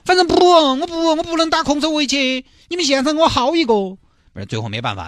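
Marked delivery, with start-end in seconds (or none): 0.0-3.7 stubborn, high-pitched insisting; high whiny groom. Acted. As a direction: whine it like a spoilt groom. (高声耍赖的新郎)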